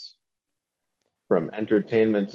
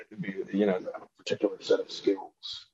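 softer, understated action star impression; Liam Neeson; 7 dB quieter than usual. Demonstrating a famous film line, hushed celebrity impression. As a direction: quietly deliver it as the actor. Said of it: "do a Liam Neeson impression".

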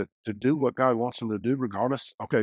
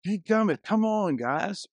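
mock outrage; 9 semitones higher, slower than usual. Reacting to boy's fart.

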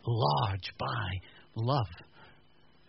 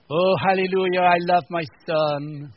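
over-the-top pious; 4 semitones higher, 7 dB louder, slower than usual. Pretend. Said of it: "That's just weirdo stuff".

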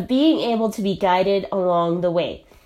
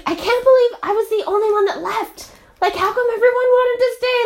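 upset disbelief; 12 semitones higher than usual. Retold or imitated. Retold distress after leaving party.

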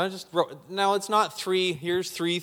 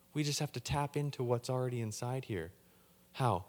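ordinary delivery

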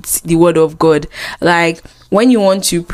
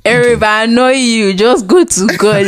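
ordinary talk

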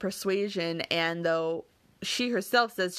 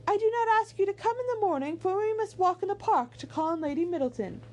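very high character voice; high, polite lady's voice; gracious hostess; 13 semitones higher than usual. A narrator's reading. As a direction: voice a hostess inviting, pitched way up. Voices Missus Dashwood in the novel.

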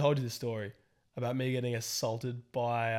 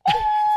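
very high mock shriek; short piercing imitation of a scream; imitated distant scream; 14 dB louder than usual. Telling of screaming heard from the cafe, imitated shriek. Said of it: "just, like, screaming".

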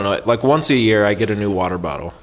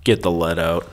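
Han Solo impression; 5 semitones lower, 4 dB quieter than usual. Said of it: "that Han Solo kind of talking".

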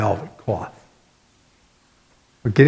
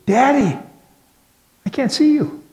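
put-on much higher, small child's voice, afraid; frightened little girl; 13 semitones higher than usual. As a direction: plead like a little girl lost in the dark.

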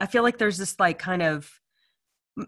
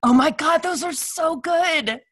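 excited gushing; 8 semitones higher, slower than usual. Real, not acted. Cohost raving about a favorite sandwich, excited gushing. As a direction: gush over it, stretching the words out.

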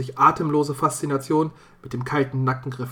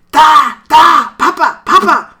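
shrill, much higher, much louder panicked screaming; frantic terrified cry; 14 semitones higher, 15 dB louder than usual. Acted. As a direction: scream as if seeing a ghost. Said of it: "sprang er wie ein angeschossener Rehbock wild auf und ab". (Knecht Theo sieht seinen toten Vater, panisch schreiend)

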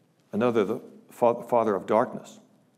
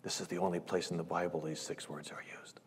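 soft, hushed and confiding; whispered aside to the congregation; 4 semitones lower, 11 dB quieter than usual. Real, not acted. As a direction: lean in and whisper it. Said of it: "hushed aside before the verse".

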